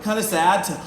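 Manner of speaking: normal talking voice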